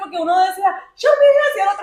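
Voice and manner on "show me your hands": high-pitched mock yell; quick high holler of a command; imitated police shout. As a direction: mimic one cop yelling an order. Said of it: "como que gritando".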